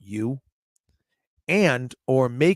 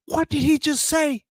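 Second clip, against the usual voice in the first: stunned disbelief; 12 semitones higher than usual. Retold or imitated. Retells his own stunned reaction.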